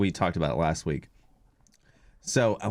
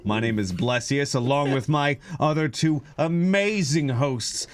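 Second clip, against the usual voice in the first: announcer intro; 2 semitones higher than usual. Acted. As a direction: present the hosts like a ring announcer. Hosts' grand podcast intro.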